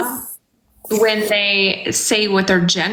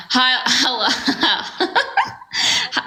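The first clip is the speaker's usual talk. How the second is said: greeting through laughter; giggling while asking; laughing; 4 semitones higher, slower than usual. Real, not acted.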